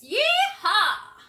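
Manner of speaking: high, loud cowboy yeehaw; cowboy whoop. Shown as a demonstration. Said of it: "Let's do our cowboy voice".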